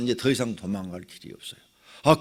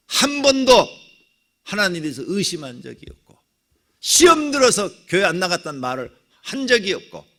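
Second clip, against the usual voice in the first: forceful preaching; 5 semitones higher, 7 dB louder than usual. Real, not acted.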